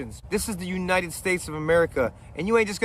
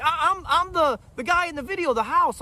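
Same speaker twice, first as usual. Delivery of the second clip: nervous neighbor; 9 semitones higher than usual. Retold or imitated. Nervous neighbor voiced high.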